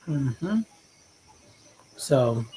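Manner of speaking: ordinary delivery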